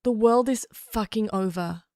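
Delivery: usual speaking voice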